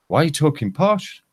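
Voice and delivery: mock challenge. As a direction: imitate the locals questioning you. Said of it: "and everybody's going".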